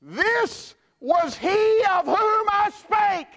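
slow, high, loud proclamation; booming prophetic shout; crying out. Acted. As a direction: cry it out like a prophet in the wilderness. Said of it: "he cried out".

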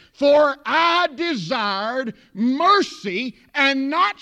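loud, high, slow preacher's cry; full-force preaching. Real, not acted. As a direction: drive each phrase home from the pulpit, drawing out key words.

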